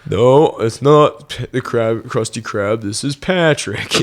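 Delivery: slow, put-on cartoon dope voice; Patrick. Friends trade cartoon quotes, slow dopey impression. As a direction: drag it out like a dim cartoon starfish.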